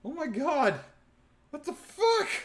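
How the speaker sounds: high, rising cry of disbelief; stunned kid. Retold or imitated. Nine-year-old watching the car explode, stunned. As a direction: blurt it out in shock. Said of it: "I was like".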